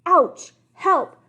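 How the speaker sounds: high, loud cries for help; crying out. Acted. Bitten king cries for help, loud and high. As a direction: yell for help in pain. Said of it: "cried the king".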